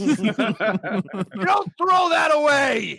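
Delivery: drawn-out, high full-voiced yell; hammed-up outraged father; shouting dad. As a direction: stretch the words into a slow bellow. Acts himself shouting back at son.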